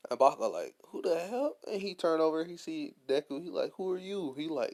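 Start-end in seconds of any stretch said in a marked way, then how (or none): 0.9-1.6 mock startled yell. Bakugo startled when Deku sits down, higher mock yell. 3.8-4.3 much higher, puzzled question; a hammed-up double take; mock surprise. Bakugo not recognising Deku, much higher confused question.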